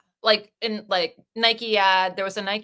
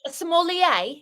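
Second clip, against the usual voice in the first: higher, put-on British accent; 6 semitones higher than usual. Shown as a demonstration. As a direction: say it in a mock British voice. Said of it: "I'll say it British".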